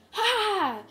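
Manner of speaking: sharp, high, loud power-up cry; battle shout. Acted. Deku launching at Frieza, a high loud battle shout. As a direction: explode into the attack with one yell.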